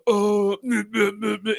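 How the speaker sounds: high mock whiny girlfriend babble; teasing impression of his girlfriend; female impersonation. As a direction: mimic her in a high whiny voice. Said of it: "doing the female impersonation".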